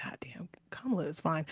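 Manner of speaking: hushed admiring whisper. Retold or imitated. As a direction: say it under your breath.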